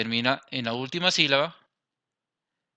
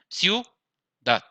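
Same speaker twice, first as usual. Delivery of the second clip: ciudad as a high, rising ciu, pause, dad; split into syllables; 5 semitones higher than usual. Shown as a demonstration. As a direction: stretch ciu in ciudad, then a separate dad. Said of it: "mayor fuerza en la segunda vocal".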